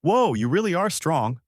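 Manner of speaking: high, admiring whoa; elated. A narrator's reading. Turtle amazed by Goku's win, elated. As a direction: marvel with mild elation. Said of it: "The turtle said elated".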